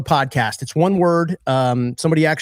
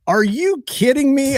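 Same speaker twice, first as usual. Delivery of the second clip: high, slow incredulous cry; exasperated disbelief; 11 semitones higher, slower than usual. Retold or imitated. Host reading morning messages, exasperated disbelief. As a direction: groan it out at the phone in disbelief.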